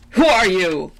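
loud, commanding challenge; king's angry cry. A narrator's reading. Angry king to the stranger disturbing his sacrifice, loud cry. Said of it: "cried the king in a terrible".

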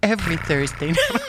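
giggling through the announcer line; stifled laugh on the words. Shown as a demonstration. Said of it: "repeats the line after laughing".

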